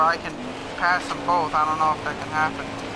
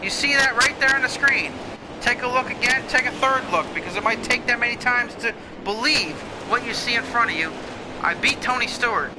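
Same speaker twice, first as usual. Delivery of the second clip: excited; 6 semitones higher than usual. Real, not acted. Gloating after beating Tony Stewart.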